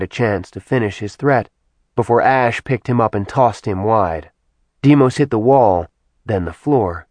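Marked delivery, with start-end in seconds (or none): none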